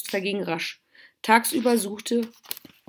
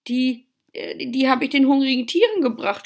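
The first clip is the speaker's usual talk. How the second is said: higher, halting start then a rush; stammering; 6 semitones higher, 6 dB louder than usual. A narrator's reading. Pauli confessing to mother about the winter stores, stammering. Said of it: "stotterte Pauli".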